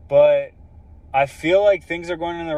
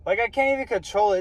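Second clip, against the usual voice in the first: emotional; 6 semitones higher, 4 dB louder than usual. Real, not acted. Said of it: "that uncontrollable bawling".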